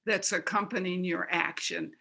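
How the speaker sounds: ordinary talk, nothing marked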